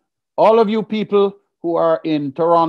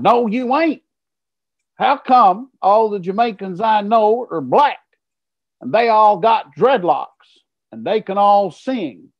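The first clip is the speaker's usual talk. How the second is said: higher, brash American heckler voice; doubting heckler; 6 semitones higher than usual. Retold or imitated. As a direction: taunt him to prove himself. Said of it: "a man jumps up and says".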